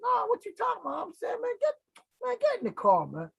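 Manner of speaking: high, fast, pressing voice; urgent coaxing. Retold or imitated. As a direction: wave him into the car, quick and high. Urging friend into car in rain.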